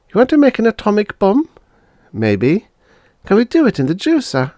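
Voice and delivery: put-on high, eager kids' chatter; children scheming; child voices. Siblings planning tomorrow, high eager voices.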